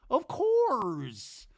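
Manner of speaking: high, drawn-out cooing reply; mock reassurance. Brittany answering drunk Zach, high mock reassurance. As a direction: put on a high placating voice.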